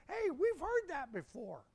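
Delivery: high, bright-eyed recognition; lightly voiced surprised disciple. Disciples at the Transfiguration recognizing the voice, mock surprise.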